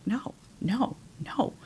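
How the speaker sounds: very soft, separated noes; quiet refusal. Retold or imitated. Telling how she answered his "relax", quiet refusal. Retelling her repeated refusals.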